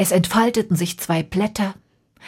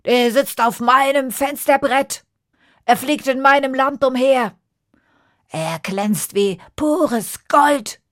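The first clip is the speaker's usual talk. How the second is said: king's voice; 5 semitones higher, 5 dB louder than usual. Acted. King admires bird at feast.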